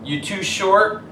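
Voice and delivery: high, loud mocking jeer; taunting bully. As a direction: sneer down at the short kid. Bully taunts Michael on court.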